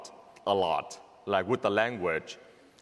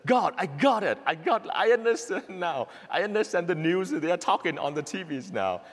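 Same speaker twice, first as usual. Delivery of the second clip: a sudden breakthrough, thrilled; joyful realization; 6 semitones higher than usual. Retold or imitated. Priest recalling the moment English clicked, elated.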